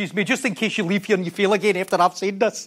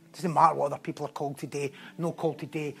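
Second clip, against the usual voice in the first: low, much softer earnest appeal; soft closing exhortation; 9 semitones lower, 12 dB quieter than usual. Real, not acted. Preacher quietly urging the congregation to follow. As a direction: draw them in gently to close.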